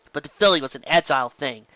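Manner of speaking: usual speaking voice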